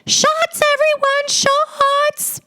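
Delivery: put-on high shrill-free squeal of a party girl calling shots; mock the roommate hyping the party; party girl yell. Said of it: "I hear this dumb bitch go".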